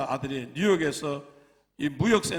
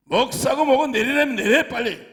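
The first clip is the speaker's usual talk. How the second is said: policeman's command; 6 semitones higher, 5 dB louder than usual. Retold or imitated. Policeman orders pastor off.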